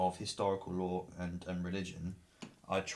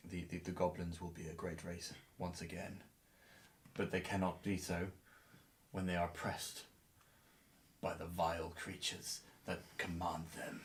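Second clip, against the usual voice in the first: lightly voiced god, lower in volume; solemn deity; 2 semitones lower, 10 dB quieter than usual. Player as the goblins' god answering them, solemn and measured.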